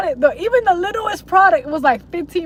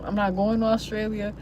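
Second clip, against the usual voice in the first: ordinary talk, nothing marked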